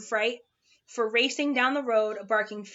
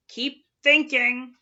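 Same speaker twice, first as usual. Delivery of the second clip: slow, louder stern order; scolding; 2 semitones higher, 6 dB louder, slower than usual. Acted. Watchdog scolding Milo to keep thinking, slow and louder. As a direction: drive the point home, stern. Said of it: "scolded the watchdog".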